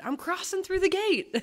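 a mild imitation of a joyful shout; retold shout. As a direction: call it out like he's crossing over. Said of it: "he just shouted".

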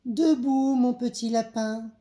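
high, loud, lilting call; mother's wake-up call. A narrator's reading. Mother waking her little rabbit, calling out high. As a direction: call out brightly to rouse a child.

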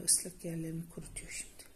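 soft whispered aside. Real, not acted. Fısıltıyla anlatıyor. (fısıltıyla)

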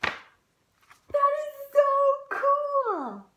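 high, loud, slow sung-out delight; thrilled squeal. Acted. Piggy delighted to be in a book, singing it out high. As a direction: stretch it out like a child who can't contain joy.

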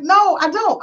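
high-pitched retold denial; protesting friend. Friend denies knowing in retold argument.

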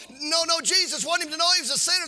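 normal talking voice

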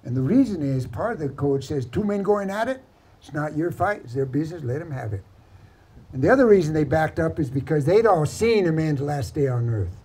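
ordinary delivery, nothing marked

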